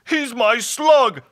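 high, loud creature wail; hammed-up blubbering holler; hollering hobgoblin. A narrator's reading. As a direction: holler it out, drawn out at the end. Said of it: "hollered the hobgoblin".

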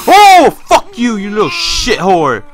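high, loud burst of swearing; yelp, then swear loudly at it; startled cursing. Real, not acted. Startled, curses the baby.

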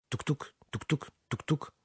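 soft rhythmic tuk tuk; quiet clack of wheels on rails; train wheels clacking. A narrator's reading. Train wheel sound imitated.

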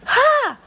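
sharp high-pitched scoff; a shocked scoff up high; disbelieving high exclamation. Real, not acted. Scoffing at the grudge.

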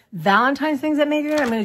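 bright laugh through words. Real, not acted. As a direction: giggle while saying it. Laugh heard.

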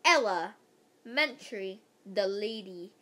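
plain reading voice

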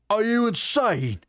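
high, drawn-out cry of disbelief; outraged shout. A narrator's reading. War chief objecting to guests staying the night, shouting. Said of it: "Uwak shouts".

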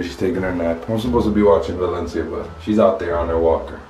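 usual speaking voice

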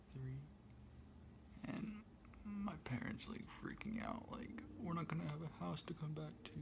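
soft unvoiced whisper. Real, not acted. Evacuated at his aunt's house at night, real hushed whisper. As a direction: hush it so nobody wakes.

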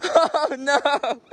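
laughing through the words. Real, not acted. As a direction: chuckle at the fall. Laughing at a fall.

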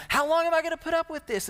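high, fast, fed-up mock complaint; exasperated God. God on the phone to Moses, exasperated and fast. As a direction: throw up your hands as a weary God. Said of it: "God's saying".

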